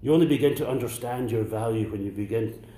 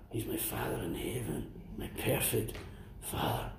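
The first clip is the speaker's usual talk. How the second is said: whispered; 11 dB quieter than usual. Real, not acted. Intimate confession of faith.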